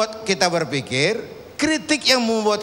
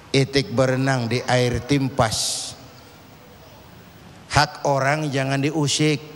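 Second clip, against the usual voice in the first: put-on lower, measured reciting of old verse; pantun recitation; 8 semitones lower than usual. Preacher recites a Malay pantun to the audience, low and measured. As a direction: recite like an elder passing on wisdom.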